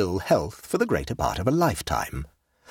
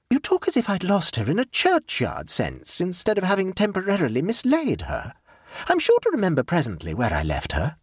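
a hint of a pleased, scatterbrained aunt; light, self-satisfied chatter; gaily; 10 semitones higher than usual. A narrator's reading. Jane's voice.

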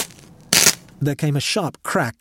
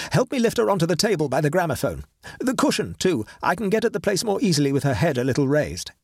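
fast, raised, busy orders; Horton, hurried; 5 semitones higher, faster than usual. Acted. Horton orders his friend to lift the body, fast and raised. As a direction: rush through the instructions like a surgeon at work.

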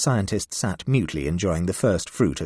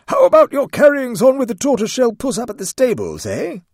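loud, much higher bluff shout; blustering; 9 dB louder than usual. Acted. Major diverting the talk at the cat, blustering shout. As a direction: barge in with a hearty bellow. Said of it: "plunged in heavily".

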